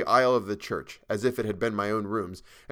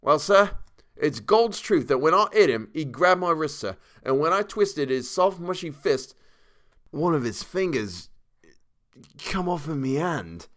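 higher Cockney voice, slowing to a horrified end; horrified Cockney servant; Cockney dialect; 6 semitones higher, 4 dB louder than usual. A narrator's reading. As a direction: confess the grisly bit in Cockney, drawing out the end. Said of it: "Thomas's Cockney dialect".

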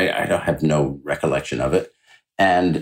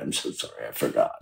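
breathy, whispered mock apology; flustered apology; 8 semitones lower, 10 dB quieter, faster than usual. Nurse apologising to the patient, hushed and flustered. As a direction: imitate the embarrassed nurse, hushed.

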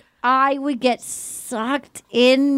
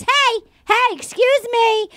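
much higher, sharp mock indignation; high-pitched mock outrage, hammed up; indignant callout; 11 semitones higher than usual. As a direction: call him out, mock-offended. [name] confronting him on day bed.

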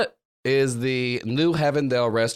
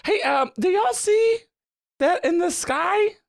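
calling out. Acted. Character calling out, alarmed.

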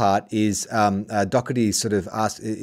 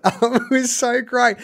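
gushing delight; 11 semitones higher, much slower than usual. Real, not acted. Gushing over the moment.

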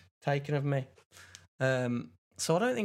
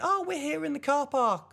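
mum's bright, much higher phone voice; lightly mimicked cheerful mother; imitating mum; 3 dB louder than usual. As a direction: chirp it up high like mum on the phone. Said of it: "she went".

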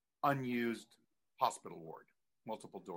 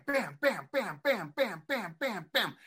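chair banging; 9 semitones higher, 4 dB louder, slower than usual. Retold or imitated.

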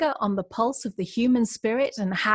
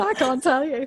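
laughing; 5 semitones higher, 4 dB louder than usual. Real, not acted. Host overcome with excitement.

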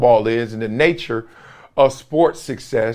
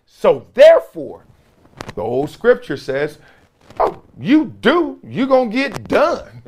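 rising, ringing preacher cadence, much higher on the key lines; preaching; 5 semitones higher than usual. Acted. Grandson of a churchgoer preaching scripture to viewers, rising sermon. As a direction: build it like a sermon from the pulpit.

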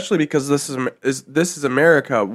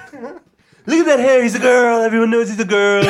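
mocking bystander; 9 semitones higher, 4 dB louder than usual. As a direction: sneer at the strange clothes.

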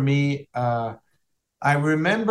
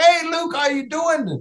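high-pitched, hearty hailing shout; imitating an exuberant hello to a buddy; upbeat greeting; 12 semitones higher than usual. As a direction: call out a big cheery hello. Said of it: "the way I've seen him in the game".

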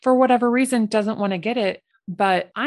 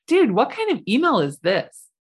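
incredulous; 6 semitones higher, 3 dB louder than usual. Real, not acted. Baffled by rude email.

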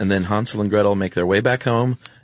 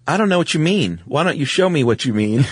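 higher, sweet feigned innocence; innocent girl voice; 5 semitones higher than usual. Retold or imitated. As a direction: play dumb in a higher girlish voice. Gretel playing dumb to witch.

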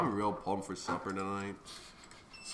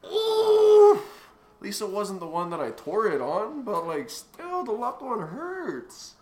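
high, strained, drawn out; whimpering through the pain; 7 semitones higher, 7 dB louder, slower than usual. Real, not acted. Still feeling the ankle pain.

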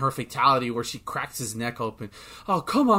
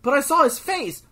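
confused disbelief; 8 semitones higher than usual. Retold or imitated. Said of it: "and, like".

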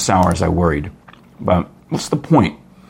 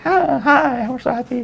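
high, soft little mock hello; mock meek greeting; 3 dB quieter than usual. Host mimics people who deal with death, meek voice. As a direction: imitate a timid greeting, high and small.

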